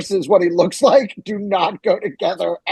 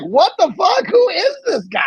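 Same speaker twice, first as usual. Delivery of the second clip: shocked disbelief; 11 semitones higher than usual. Retold or imitated. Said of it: "I'm like".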